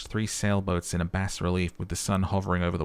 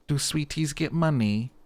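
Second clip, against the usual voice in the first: put-on childlike question; 7 semitones higher than usual. Voicing detective asking old lady.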